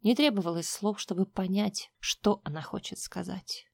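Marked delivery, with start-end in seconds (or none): none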